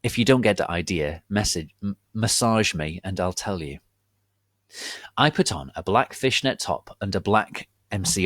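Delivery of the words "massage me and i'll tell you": ordinary delivery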